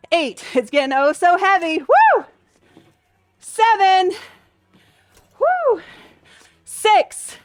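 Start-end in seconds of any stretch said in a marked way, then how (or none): 1.8-2.2 high "woo" from the exertion; effort whoop. Real, not acted. Instructor feeling the heavy reps, high effort whoop. Exhales as the weight gets heavy. 5.4-5.8 high "woo" on an exhale; effort woo. Real, not acted. Instructor puffing through her workout, high effort woo.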